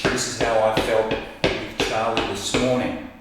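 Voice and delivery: ordinary talk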